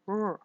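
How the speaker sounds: smooth, held English R sound; R with no tap or friction; approximant R. Shown as a demonstration. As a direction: hold an untapped postalveolar R. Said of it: "use the postalveolar approximant".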